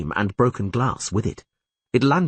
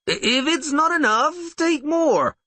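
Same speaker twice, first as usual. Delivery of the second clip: much higher, stiff and forced; unnatural; slower than usual. A narrator's reading. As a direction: fake a casual offer, badly. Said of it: "said the innkeeper unnaturally".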